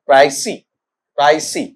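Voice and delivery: प्राइसी with a diphthong and silent s; प्राइसी, ending on a long ee; correct pronunciation. Shown as a demonstration. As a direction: say प्राइसी as "pray-see". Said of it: "इसका उच्चारण बेटा देखो इस तरीके से".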